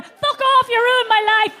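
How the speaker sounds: high, loud, exasperated blast; mock outburst. Comedian cursing duvet covers, loud and high. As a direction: explode at the duvet.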